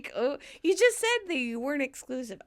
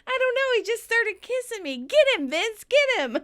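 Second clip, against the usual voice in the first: high, urgent mock plea; feigned alarm; 10 semitones higher, 6 dB louder than usual. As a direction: egg him on in a high fake panic. Phoebe feigns innocence, eggs on.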